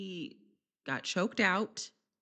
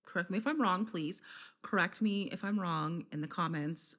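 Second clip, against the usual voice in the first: ordinary talk, nothing marked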